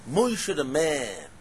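slow, weighty "Moshe the man"; stress on MAN. Shown as a demonstration. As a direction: hammer "Moshe" and stretch "man" as it falls. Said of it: "the emphasis on the pasuk".

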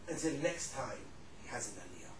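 retold prophecy, sinking in pitch and volume; hushed, ominous close; grave warning. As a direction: drop low and soft to finish the warning. Acted warning drops to grave ending.

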